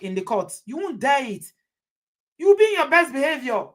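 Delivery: ordinary delivery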